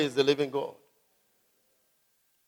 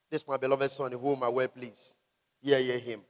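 hushed voice of heaven; 10 semitones lower, 16 dB quieter than usual. Retold or imitated. Voice from heaven quoted softly.

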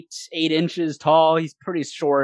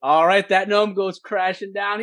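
ordinary talk, nothing marked

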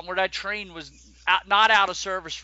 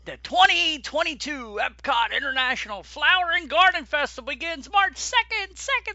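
announcer voice; 9 semitones higher, 3 dB louder than usual. Acted. Dramatic announcement, rising repeats.

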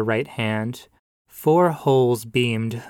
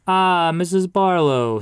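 pastor greeting; 7 semitones higher, 5 dB louder than usual. A narrator's reading. Pastor greeting the widow outside church, hearty. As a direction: sing it out like a warm cleric.

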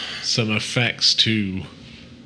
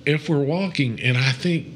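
ordinary talk

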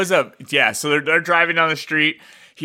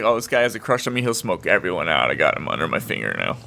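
put-on scheming girl voice; 10 semitones lower, 3 dB quieter than usual. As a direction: lower and softer, a hint of scheming.

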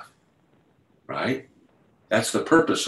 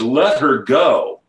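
slow, raised, emphatic retold order; commanding; 4 semitones higher, 5 dB louder, slower than usual. Healer ordering the ladies once more, commanding. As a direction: stretch each word firmly, higher and louder.

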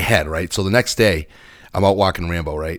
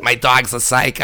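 higher, slow mock Boston girl voice; Boston girl accent; 4 semitones higher than usual. Comic repeating the dog owner's line, mock Boston accent. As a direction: mimic a Boston girl, stretching it out. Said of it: "that fucking voice".

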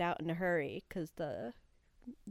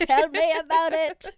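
much higher, louder, laughing through it; loud laughing shout; 9 semitones higher, 13 dB louder than usual. Acted. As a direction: belt it out while laughing. Loud laughing take of catchphrase.

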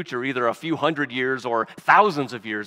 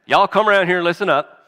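faster, louder, higher Southern call; Southern accent; 6 semitones higher, 7 dB louder, faster than usual. Pretend. Jacob summoning his sons in a hammed-up Southern accent. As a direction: holler it like a Southern dad rounding up his kids. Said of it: "if he had an accent like that".

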